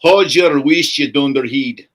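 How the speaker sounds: loud Scottish telling-off; Scottish accent. Acted. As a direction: scold in a broad Scottish brogue. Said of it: "That's Scottish for".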